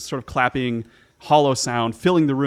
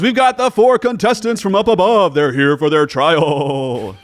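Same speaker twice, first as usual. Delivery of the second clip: booming, higher announcer voice; fighting game announcer; 4 semitones higher, 8 dB louder than usual. Acted. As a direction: hype up the contestants, arena style. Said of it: "The announcer voice got me".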